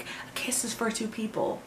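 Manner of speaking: very soft, hushed romantic coach; Colin. Retold or imitated. Imagining a man teaching a woman to kiss, hushed imitation. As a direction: drop to a soft, intimate imitation. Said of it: "That is so Coach Colin".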